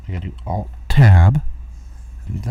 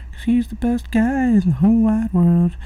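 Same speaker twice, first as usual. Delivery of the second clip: sarcastic praise; 14 semitones higher than usual. Acted. Mocking David Hogg's tech skills.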